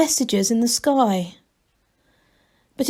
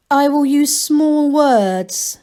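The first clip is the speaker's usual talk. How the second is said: slow, higher and louder computer voice; machine voice; 6 semitones higher, 6 dB louder, slower than usual. A narrator's reading. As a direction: speak slowly as a patient machine. The thing, machine voice.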